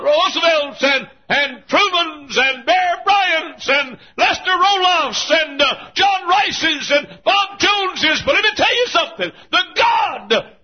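very high, loud, slow shouted cadence; full-force preaching climax. Acted. Preacher mourning fallen heroes to the congregation, shouting. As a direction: drag each name out in a rising shout.

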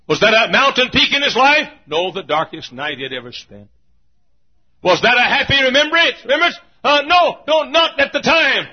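high, loud, ringing pulpit proclamation; preaching at full force. Acted. Preacher to his congregation, proclaiming at full force. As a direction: build each line to a shouted peak. Rhetorical questions preached aloud.

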